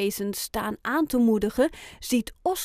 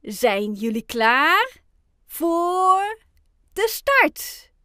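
slow call, building higher and louder; teachers rallying group; 7 semitones higher, 6 dB louder, much slower than usual. A narrator's reading. Teachers ask the group at the start line, calling out. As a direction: stretch it out, rising to the start. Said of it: "vragen aan de groep".

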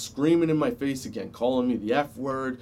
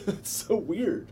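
slow, high laugh through the words; 7 semitones higher, 5 dB quieter, slower than usual. Real, not acted. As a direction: laugh it out, amused. Laughing at his own story.

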